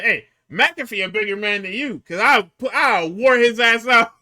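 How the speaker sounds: loud, high boastful taunt; mock promo shout. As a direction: shout the retold taunt, stretching the end. Acting out wrestler's boastful taunt.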